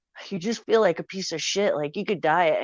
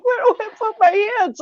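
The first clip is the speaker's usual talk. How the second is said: much higher and louder panicked question; mother panicking at gunpoint, high and loud; freaking out; 8 dB louder than usual. Retold or imitated. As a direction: shriek it in a panic. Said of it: "freaking out".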